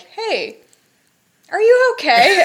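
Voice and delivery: high, loud, caring rising ask; concerned professor. Retold or imitated. Imitating her professor checking on her, concerned. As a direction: ask like a worried teacher. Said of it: "just coming up to me and being like".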